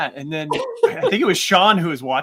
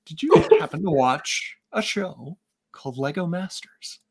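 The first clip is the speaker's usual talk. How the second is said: slow teasing hint; slower than usual. Retold or imitated. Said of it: "I was like".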